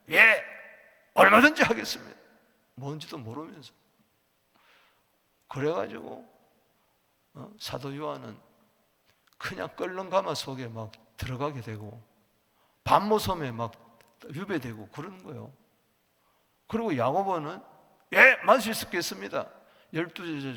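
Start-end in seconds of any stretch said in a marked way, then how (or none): 0.1-2.2 loud, higher, rushed acted promise; overeager yes. Disciples' overeager answer, acted. 18.1-19.5 loud, higher acted pledge; overeager yes. James's overconfident answer, acted.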